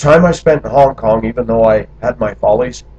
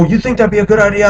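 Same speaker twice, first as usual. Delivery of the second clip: much higher, quicker questioning voice; imitated puzzled friends; 10 semitones higher, faster than usual. Friends who missed the sarcasm asking back, puzzled. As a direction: mimic a literal-minded listener in a high-pitched rush. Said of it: "And they go".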